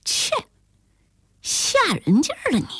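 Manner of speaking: pouty scoff, pitch swooping high; huffy, half-playful reproach; mock scolding. A narrator's reading. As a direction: scold a friend playfully for the scare. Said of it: "我嗔道".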